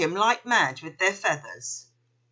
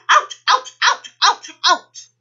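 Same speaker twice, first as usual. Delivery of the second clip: high, loud emu shout; 12 semitones higher, 10 dB louder than usual. A narrator's reading. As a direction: yell it out in pain. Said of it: "clutching his bottom and shouting".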